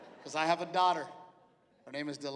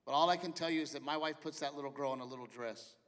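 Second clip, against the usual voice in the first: ordinary talk, nothing marked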